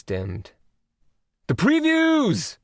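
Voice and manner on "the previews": high, slow, thrilled cry of the previews; eager high-pitched call; excited exclamation. A narrator's reading. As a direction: gasp it out like a movie fan. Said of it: "Craig said".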